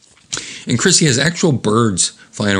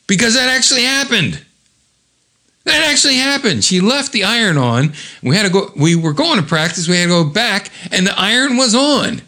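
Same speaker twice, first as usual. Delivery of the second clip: much higher, animated voice; emphatic, excited insistence; 10 semitones higher than usual. Real, not acted. Narrator swears the iron story happened, excited high insistence. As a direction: insist it is true, pitched right up.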